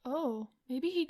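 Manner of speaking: ordinary talk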